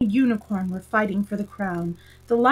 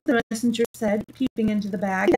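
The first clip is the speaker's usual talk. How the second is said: usual speaking voice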